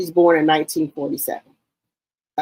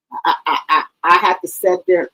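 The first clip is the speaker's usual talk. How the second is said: ordinary talk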